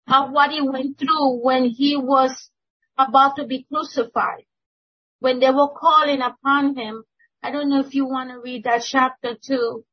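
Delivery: loud, excited testimony; too excited. Real, not acted. Church member urging the elder to read a chapter, excited. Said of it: "I'm too excited".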